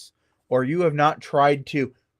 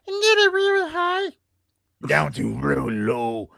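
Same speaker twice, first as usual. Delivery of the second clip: high to low demo. Shown as a demonstration. Showing haunters the high to low voice range. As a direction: swoop the voice from top to bottom. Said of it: "you have to practice".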